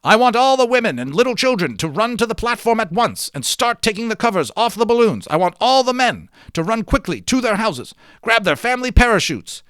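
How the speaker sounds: high, loud urgent orders to the whole room; shouting instructions. Acted. Leader shouting evacuation orders to the families, loud and urgent. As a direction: bellow the evacuation orders over the rumble. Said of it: "started shouting instructions".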